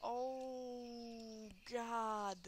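normal talking voice

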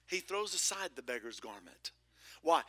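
usual speaking voice

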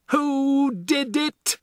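slow, high, loud bellow; roaring. A narrator's reading. Headmistress to the silent class, slow furious roar. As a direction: roar the question at the whole class. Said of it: "she roared".

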